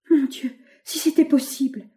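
hopeful cry. A narrator's reading. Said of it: "s'écria André".

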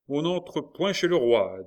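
loud, high, slow barring order; firm refusal. A narrator's reading. The officer bars the queen, loud and firm. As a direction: stop her at the door. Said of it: "dit l'officier en s'avançant vivement".

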